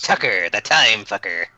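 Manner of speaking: low, slow gnome growl; a sinister little gnome; evil gnome voice. Acted. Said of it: "that sounds like an evil gnome".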